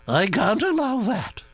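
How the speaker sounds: put-on high, brisk aristocratic old lady; old duchess voice. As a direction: gossip like an imperious old duchess. Duchess decides.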